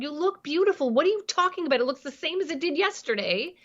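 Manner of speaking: put-on breezy dismissive parent brushing off a teen's panic; dismissive reassurance. Shown as a demonstration. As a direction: wave away her worry with quick, high cheer. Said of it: "instead of saying".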